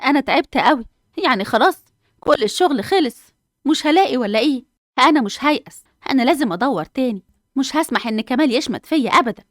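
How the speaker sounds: tired, then steeling herself not to give up; frustrated job seeker. Acted. Mother Hanaa despairing over job. (صوت أم شابة تعبانة ومصممة)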